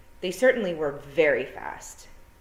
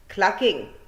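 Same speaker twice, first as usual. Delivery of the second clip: a high Scots wee-man echo; Scottish pictsie voice; 3 semitones higher, 6 dB louder than usual. A narrator's reading. Second wee man agreeing with his mate, eager Scots. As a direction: chime in eagerly, broad Scots.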